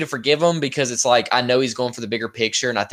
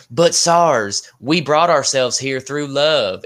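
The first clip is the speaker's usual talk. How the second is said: put-on Southern accent; 3 dB louder, much slower than usual. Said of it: "my Southern accent is horrible".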